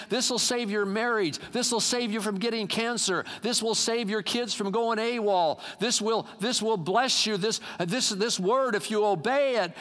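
high, insistent appeal; preached crying out. Retold or imitated. God crying out wisdom to save His people, urgent preached appeal. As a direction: call out each promise to the congregation. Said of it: "he's crying out the wisdom, saying".

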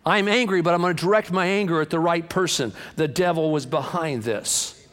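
high, forceful preacher's declaration; fired-up preaching. Real, not acted. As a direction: preach it like a fighting vow. Preaches at full force against devil.

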